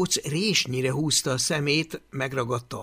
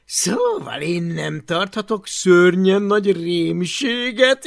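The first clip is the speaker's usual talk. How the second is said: high, loud sneering sing-song taunt; mocking bully Takonypóc; 7 semitones higher, 7 dB louder than usual. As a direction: belittle the little dragon. Takonypóc gúnyolódik. (magas, csúfolódó gúnyhang)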